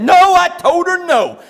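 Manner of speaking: high, loud worked-up protest; mock indignant bellow, tongue-tied; Buddy. Buddy protests to the preacher, worked up. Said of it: "when he gets real worked up".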